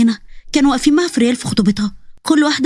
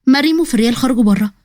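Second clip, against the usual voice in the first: ordinary delivery, nothing marked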